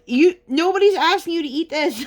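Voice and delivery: high, loud outburst at mom; exasperated shout. Retold or imitated. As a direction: shout it at her, fed up.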